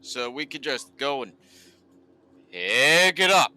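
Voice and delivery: annoyed, rising, stretched-out complaining; frustrated rant. Real, not acted. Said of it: "this is actually kinda getting frustrating".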